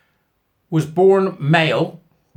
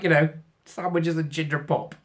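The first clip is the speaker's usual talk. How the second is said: normal talking voice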